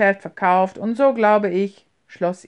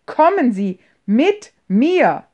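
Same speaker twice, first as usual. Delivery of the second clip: loud, slow, higher shouted command; 4 semitones higher, 8 dB louder, slower than usual. A narrator's reading. Behrmann yells at James while dragging him upstairs. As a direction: drag him along, yelling. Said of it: "schrie Behrmann".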